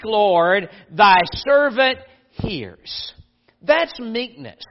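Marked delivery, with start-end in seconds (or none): 0.0-3.1 louder and slow, a reverent reply; solemn slow answer. Retold or imitated. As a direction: give it slowly as a vow. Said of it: "And so he said".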